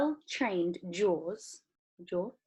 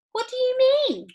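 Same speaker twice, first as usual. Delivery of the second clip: very high, loud question; startled disbelief; puzzled girl; 9 dB louder than usual. A narrator's reading. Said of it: "said Violet".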